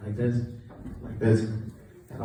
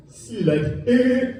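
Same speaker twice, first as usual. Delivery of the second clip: imitating Sammy; 9 semitones higher, 4 dB louder, slower than usual. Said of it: "Sammy turns to Chi-Chi and goes".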